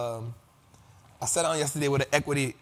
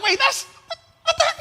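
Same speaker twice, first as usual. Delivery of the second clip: much higher, confused disbelief; baffled; 5 dB louder than usual. Retold or imitated. Host replaying his own confused reaction to the lyrics, high and baffled. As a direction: squeak out total confusion. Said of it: "I was like".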